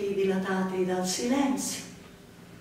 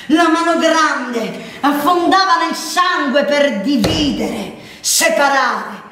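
loud, slow, forceful dramatic narration; violent climax; 9 dB louder, slower than usual. A narrator's reading. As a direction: drive out each phrase like a blade going in. Narrator relives the blade's violence.

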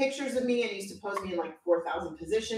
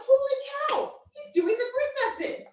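amazed exclamation; 4 dB louder, faster than usual. Retold or imitated. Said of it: "I was like".

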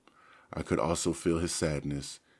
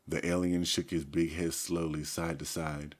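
plain reading voice

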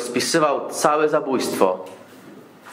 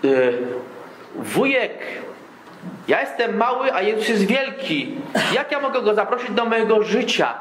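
put-on lightly raised, childlike questioning voice with a laugh; small boy; 7 semitones higher than usual. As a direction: voice a little boy asking the preacher, higher. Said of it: "chłopczyk mały ... i mówi".

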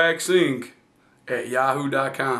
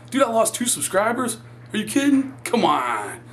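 mock outrage; 8 semitones higher than usual. Mock outrage over subscribers.

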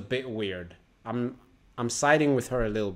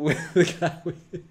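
laughing; 2 semitones higher than usual. Real, not acted.

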